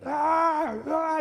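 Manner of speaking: high, loud mock screech; piercing pretend child's wail; imitated toddler scream. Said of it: "empieza a gritar".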